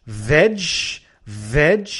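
VEG ending in a dzh sound; strong G demonstration. Shown as a demonstration. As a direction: model VEG like "vedge", punched. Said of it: "And then la G fuerte".

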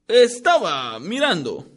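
mildly evasive, slowed. A narrator's reading. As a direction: dodge the question, hiding something. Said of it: "dijo con una voz evasiva".

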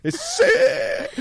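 wordless high cry of joy; hammed-up happy shriek, kept thin; high gleeful squeal. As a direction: squeal with pure happiness. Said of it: "ve la felicidad que refleja".

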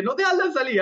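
high pitched urgent shout; frantic yell. Retold or imitated. Edu shouting from the hallway, frantic high yell. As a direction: yell at them not to let it out. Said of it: "El Edu decía".

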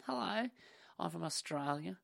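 high mock Australian "Hello"; lightly put-on Australian voice; Australian accent. Mimicking a woman from an Australian clip in an Australian accent. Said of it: "there was an Australian one".

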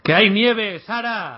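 loud, high and fed up; exasperated shout. Real, not acted. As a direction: yell the point across. Exasperated correction in an argument.

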